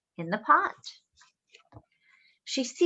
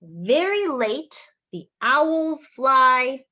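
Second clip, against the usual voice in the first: slow, drawn-out and louder; storybook reading; 7 dB louder, much slower than usual. Acted. Reading to children, slow and drawn out. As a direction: stretch the words out for suspense.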